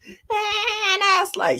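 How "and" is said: high, drawn-out imitation of a child wailing; mock wail. Nana mimicking the bitten-back toddler crying, mock wail. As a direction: stretch it into a pretend bawl.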